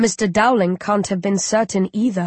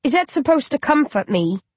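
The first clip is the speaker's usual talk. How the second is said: snapping; 6 semitones higher than usual. A narrator's reading. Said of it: "I snap".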